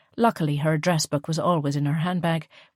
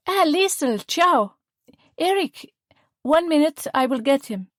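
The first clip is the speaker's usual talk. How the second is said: put-on much higher, bright Italian-accented welcome; 10 semitones higher, 4 dB louder, slower than usual. A narrator's reading. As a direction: Gabriella greets Liesl and calls Eric. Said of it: "An Italian-accented woman's voice".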